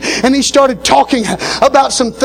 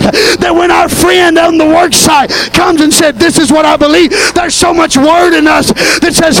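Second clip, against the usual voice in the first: loud, insistent pulpit shouting; full-force preaching; 5 semitones higher, 4 dB louder than usual. Acted. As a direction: hammer the truth home to the congregation. Scripture turns into shouted preaching.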